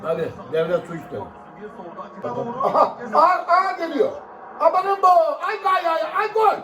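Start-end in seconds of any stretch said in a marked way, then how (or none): 2.6-6.5 rising excitement to a goal shout. Real, not acted. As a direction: voice climbing higher and higher, then yelling. Watching a goal chance on TV.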